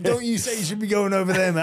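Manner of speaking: high, loud hammed-up mate telling him off; bossy mate. Mate warning the host over beers, high and loud. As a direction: play the mate warning him, pitched well up. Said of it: "he's like".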